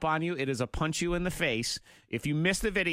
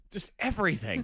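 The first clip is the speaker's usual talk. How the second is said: ordinary talk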